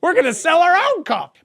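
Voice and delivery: high, loud hammed-up huffy declaration; mock brand voice. Parodying the company, a high loud huff. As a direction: announce it like a pouting victim.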